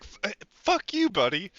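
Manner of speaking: high, irritated prisoner snarl. Retold or imitated. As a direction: snap at him like a chained prisoner. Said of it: "and he's like".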